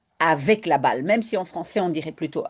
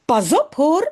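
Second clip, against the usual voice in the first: mother's "Pas op hoor" higher and louder; warning; 7 semitones higher, 5 dB louder than usual. Acted. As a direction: warn the children sharply. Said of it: "zegt moeder". ("Pas op hoor" en mise en garde de maman)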